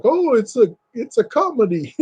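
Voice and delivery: high, mock-innocent voice; high mock-innocent excuse. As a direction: claim it like a cover-up. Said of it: "he was like".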